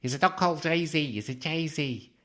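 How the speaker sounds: put-on a second go, a proper Liverpool accent.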